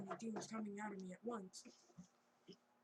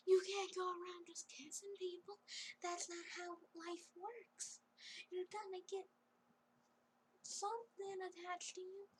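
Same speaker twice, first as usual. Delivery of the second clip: high-pitched mock telling-off; flustered scolding; 10 semitones higher than usual. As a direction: scold her in a put-on high voice. Acted scolding of the kisser.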